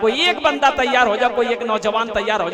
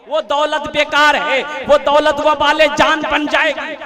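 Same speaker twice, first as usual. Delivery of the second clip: preaching emphasis; 4 semitones higher, 6 dB louder, slower than usual. Acted.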